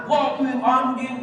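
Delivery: high, drawn-out mock insults; mock angry name-calling. Preacher imitating angry people cursing with animal names, mock angry. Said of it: "disebut nama-nama binatang di kebun binatang".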